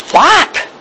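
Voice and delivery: high shocked what. Retold or imitated. Wife hearing husband lost his job, high shocked what. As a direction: react with sudden disbelief. Wife's shocked reaction to news.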